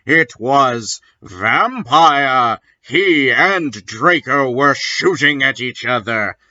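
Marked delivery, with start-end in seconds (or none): none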